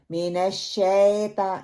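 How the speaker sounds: slow MENASH SHETA for मिनश शैता; majhool mistake. Shown as a demonstration. Teacher rejecting the mistake, SHETA with flattened vowel. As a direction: spread AY into plain E in शैता. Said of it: "नहीं".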